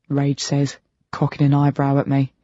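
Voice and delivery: ordinary delivery, nothing marked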